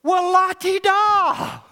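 high, slow, mocking la-ti-da; mock their pettiness, drawn out; sarcastic la-ti-da. Real, not acted. Preacher mocking the angry grudge holder, sarcastic.

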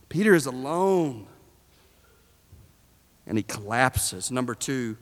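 ordinary talk, nothing marked

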